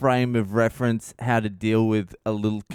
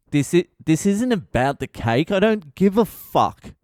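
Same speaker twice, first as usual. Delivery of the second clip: rising anger; 7 semitones higher, 3 dB louder than usual. Retold or imitated. Losing temper at the kid.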